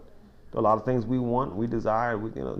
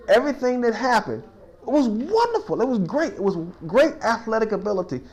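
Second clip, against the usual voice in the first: excited; 8 semitones higher, 4 dB louder than usual. Retold or imitated. Marvelling at the great play.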